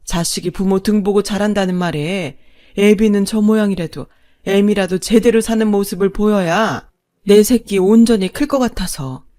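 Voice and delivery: loud, bitter tirade; long-suffering wife, finally furious. Acted. Wife telling off her husband after years of enduring, bitterly furious. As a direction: let years of endurance burst out hard.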